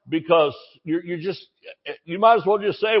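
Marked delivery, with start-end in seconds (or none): none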